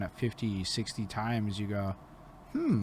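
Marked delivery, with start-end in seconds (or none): 2.5-2.8 high pondering hmm; a long musing hum; thoughtful hum. Retold or imitated. His reaction to repeated praise.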